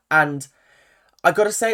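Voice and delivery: ordinary talk, nothing marked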